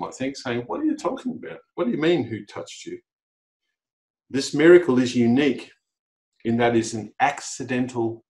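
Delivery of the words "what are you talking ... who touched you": incredulous, mocking question, pitch jumping high then dropping off; sarcastic disciples. Disciples mocking Jesus for asking who touched him, sarcastic. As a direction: scoff at him as if the question is absurd. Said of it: "displaying a little bit of sarcasm".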